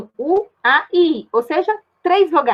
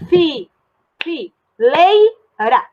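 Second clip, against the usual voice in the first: fi, fi, leira broken slowly into beats; syllable by syllable; 3 semitones higher, 3 dB louder, much slower than usual. Shown as a demonstration. Teacher dividing fileira into syllables. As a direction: chop fileira into slow syllables. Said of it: "Opa, fileira".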